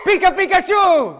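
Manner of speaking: high shouted Pikachu cry. Acted. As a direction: squeal it like Pikachu. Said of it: "She has a very nice superhero sound".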